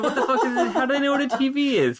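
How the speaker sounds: high-pitched, laughing exasperation; mock annoyed. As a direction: replay your baffled outrage, half laughing. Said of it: "my being annoyed".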